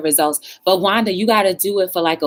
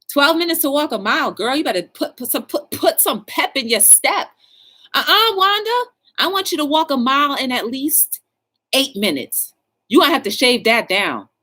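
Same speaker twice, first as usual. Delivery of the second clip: high, pushy trainer calling out to Wanda; sassy fitness coach; 8 semitones higher than usual. Pretend. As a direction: play the tough coach hyping her up.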